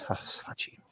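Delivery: unvoiced whisper of exasperation; whispered sigh. Man imitates his Italian grandmother, whispered. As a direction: breathe it out like the grandmother. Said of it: "And I was just always".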